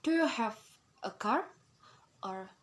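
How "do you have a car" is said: pause, then lift "car" upward as a question; rising intonation. Shown as a demonstration. Teacher demonstrating rising intonation, "car" going up in a question. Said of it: "explain about rising intonation".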